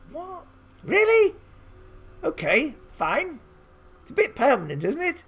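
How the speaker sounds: amused shock at a tattoo demand; surprised. Real, not acted. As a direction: react in amused disbelief. Reacts to odd instruction.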